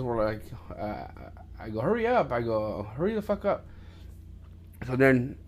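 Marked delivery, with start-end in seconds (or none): 1.8-2.3 high-pitched impatient quote, not shouted; mock impatient call, pitched up; retold urging. Retold hurrying his brother along. 3.0-3.6 high, exasperated quote at modest volume; mock-annoyed, pitched-up nagging; retold impatient urging. Retold hurrying his brother, now swearing.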